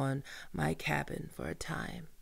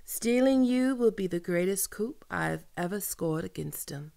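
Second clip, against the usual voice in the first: ordinary delivery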